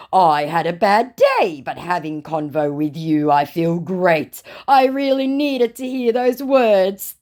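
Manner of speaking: slow, overplayed fake cheer; mock unbothered. Scammer faking good cheer to hide being bothered. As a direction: pretend nothing stings. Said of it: "pretend like he's not bothered".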